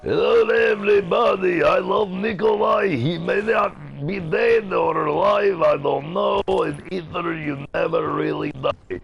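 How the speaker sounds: loud, very high put-on character voice; high-pitched cartoonish greeting at full volume; character impression. Shown as a demonstration. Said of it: "think I use a voice changer to do that".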